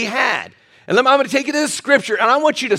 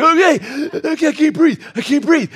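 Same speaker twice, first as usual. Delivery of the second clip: higher, mock breathless protest; squeezed and gasping; 6 semitones higher than usual. Pastor squeezed in a bear hug, mock breathless. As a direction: play being crushed in a hug. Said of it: "And I'm like".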